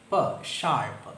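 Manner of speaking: ഷാർപ്പ് said slowly, r left silent; slow sound-by-sound demo. Shown as a demonstration. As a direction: sound out പ then ഷാർപ്പ് deliberately. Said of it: "transcribe ചെയ്യുമ്പോൾ". (പതുക്കെ ഷാർപ്പ് റ ഇല്ലാതെ)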